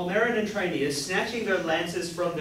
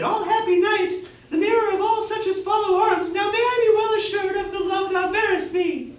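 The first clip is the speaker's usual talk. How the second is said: princess voice; 14 semitones higher, 5 dB louder than usual. Acted. Rafe reading the rescued princess's thanks to her knight, high falsetto.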